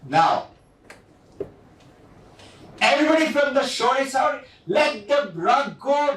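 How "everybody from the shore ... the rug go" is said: calling out. Retold or imitated. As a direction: shout it across the beach. Calling people off the shore.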